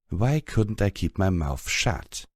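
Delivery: ordinary delivery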